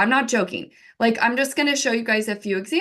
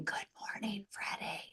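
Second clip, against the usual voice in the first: soft, creepy whispered greeting; weirdo whisper; 2 semitones lower, 19 dB quieter than usual. Retold or imitated. Mom whispering good morning to the pet gecko, weirdo whisper. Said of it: "like a weirdo".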